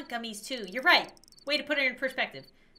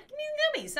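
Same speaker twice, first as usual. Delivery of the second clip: very high squeaky-pitched plea; greedy demand; 14 semitones higher, 3 dB quieter, much faster than usual. Pretend. Player still wants gummies despite ghost, high-pitched demand.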